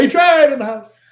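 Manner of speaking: high, loud hype-man call; hype shout. Acted.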